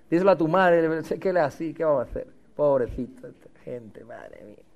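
low, soft mock muttering; hushed, resigned complaint trailing almost to nothing; grumbling under breath. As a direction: grumble privately about the long sermon. Acts out congregation grumbling about preacher.

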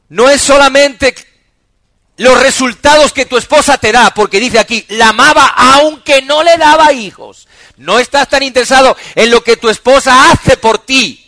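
loud, high preaching, every phrase driven hard; full-force preaching. Real, not acted. Pastor preaching to the congregation, loud and high. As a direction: preach it out to the whole church.

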